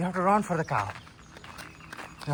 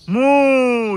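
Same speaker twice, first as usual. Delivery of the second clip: cow moo; 6 semitones higher, 7 dB louder than usual. Retold or imitated. Imitating cow for child.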